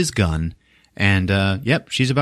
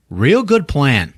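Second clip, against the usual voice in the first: slow, high, drawn-out sarcasm; acted sarcasm; 5 semitones higher, 4 dB louder, slower than usual. Rusty to Cindy in the failing shed, heavy sarcasm. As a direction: stretch it out in mock praise.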